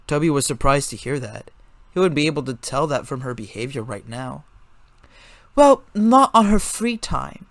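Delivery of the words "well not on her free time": put-on high, slow correcting girl voice; high girl correcting, slowly. A narrator's reading. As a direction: set him straight in Valentine's high voice. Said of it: "Valentine corrected".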